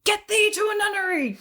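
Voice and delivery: high, loud theatrical command; dramatic decree. Acted. Bard banishing the ghost with a loud theatrical line. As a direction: hurl it at the ghost like Shakespeare. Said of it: "what do you say to the ghost".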